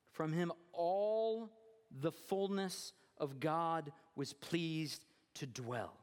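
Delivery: slow, drawn-out proclamation; deliberate pulpit climax, word by word; emphatic scripture reading. Acted. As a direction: stretch each phrase with weight. Scripture climax, then repeated hushed.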